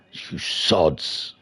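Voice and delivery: soft unvoiced grumble under the breath; whispered jab. Real, not acted. Streamer whispers at rival drivers who beat him. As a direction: whisper it at the screen.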